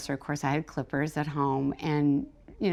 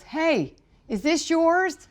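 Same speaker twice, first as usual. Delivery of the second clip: retold call across lanes; 12 semitones higher, 5 dB louder, slower than usual. Swimmer calls from three lanes over.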